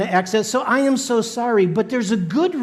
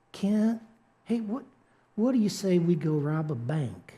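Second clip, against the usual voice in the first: put-on silky North Carolina accent; 5 dB quieter than usual. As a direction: coax softly in a silky Carolina lilt. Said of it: "he spoke with this silky, smooth accent".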